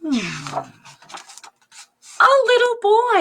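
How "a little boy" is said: high, loud happy reveal; excited reveal. Acted. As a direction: announce the boy brightly. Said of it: "reveals guessed visitor".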